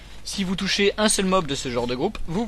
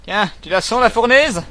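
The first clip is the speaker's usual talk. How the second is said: loud, high rising battle taunt; hammed-up gloating shout; playful taunt; 7 semitones higher, 10 dB louder than usual. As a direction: crow it at the enemy you are hitting. Taunting an enemy while attacking.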